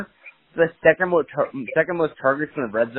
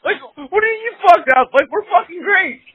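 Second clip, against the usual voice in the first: much higher, louder hyped boast; cocky excitement; 4 dB louder than usual. Retold or imitated. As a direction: brag like you just won. Retold hyped reassurance continues.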